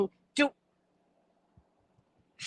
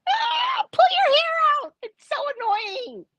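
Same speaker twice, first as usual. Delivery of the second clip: high-pitched wail of frustration; hair-pulling, high comic whine; exasperated outburst; 13 semitones higher than usual. Acted. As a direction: cry out high, at your wits' end.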